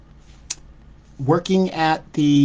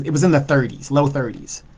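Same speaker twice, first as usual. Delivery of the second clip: normal talking voice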